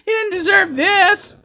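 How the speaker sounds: high-pitched whiny pity, imitated; put-on distressed fan voice; mock sympathetic cheering. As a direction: wail in mock pity for the poor underdog. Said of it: "Renee's just cheering for Elias".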